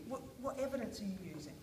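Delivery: ordinary talk, nothing marked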